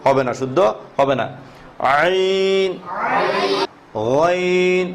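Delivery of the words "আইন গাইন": আইন and গাইন drawn from the throat; throat letters. Shown as a demonstration. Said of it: "কণ্ঠনালীতে যাবে".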